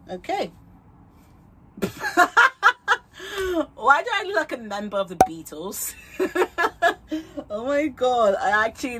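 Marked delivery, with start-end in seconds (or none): 1.8-3.7 loud laugh. Real, not acted. Laughing at own wig look. 6.0-7.4 laugh. Real, not acted. Laughing at own wig look.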